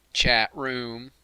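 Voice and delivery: drawn-out, oddly delivered call-out; odd announcement. Real, not acted. Host oddly hailing the chat room, weird drawn-out announcement. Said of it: "why I said it like that".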